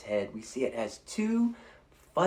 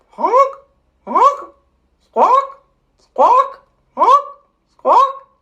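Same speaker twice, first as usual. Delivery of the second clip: very high loud squawks; shrieking bird calls; flamingo honks; 19 dB louder, much slower than usual. Shown as a demonstration. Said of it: "Let's try fluting like that flamingo".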